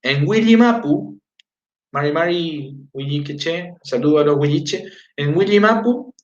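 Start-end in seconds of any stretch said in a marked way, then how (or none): none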